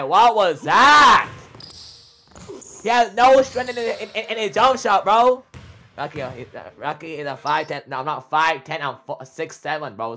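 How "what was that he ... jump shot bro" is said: high, loud, slow disbelieving shout; incredulous yell. Real, not acted. Streamer yelling at teammate's weak jump shot, loud disbelief. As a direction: scream at the awful shot.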